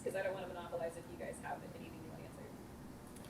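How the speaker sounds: whispering. Real, not acted. Aside while offering questions.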